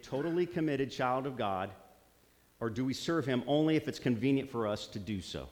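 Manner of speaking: ordinary talk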